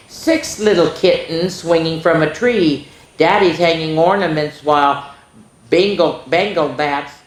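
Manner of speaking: put-on lively read-aloud for young kids; sing-song picture book voice; storybook reading.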